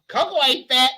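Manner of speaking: loud, high mock outrage cut by laughter; loud sassy retort. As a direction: bellow it like a diva insulted. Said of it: "That bitch said".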